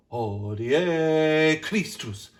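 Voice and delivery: Christus sung slow, R flipped. Shown as a demonstration. Director shows a flipped R in Christus. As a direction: tap the R in Christus. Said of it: "flip Christus".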